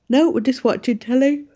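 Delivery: words stretched by a big yawn. Real, not acted. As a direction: yawn right through the line. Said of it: "Oh, sorry, I did a big yawn there".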